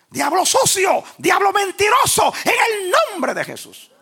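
imitating preachers casting out the devil, very high and loud; full-throated mock exorcism shout; shouted rebuke. Preacher mimicking Pentecostals insulting the devil, shouted rebuke. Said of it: "cuando reprendían, insultaban al diablo".

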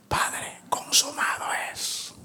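breathless whispered last words; dying whisper. Acted. Preacher voicing dying Jesus to the Father, faint whisper.

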